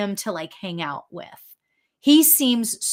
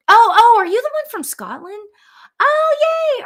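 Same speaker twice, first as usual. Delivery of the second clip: high, loud, delighted outburst; bursting with sudden delight, much higher and louder; excited squeal; 12 semitones higher, 9 dB louder than usual. Real, not acted. Psychic thrilled the granddad is the Scottish one, excited squeal.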